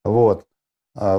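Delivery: ordinary talk, nothing marked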